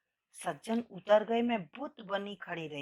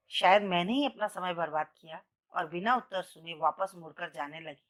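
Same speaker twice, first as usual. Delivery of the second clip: usual speaking voice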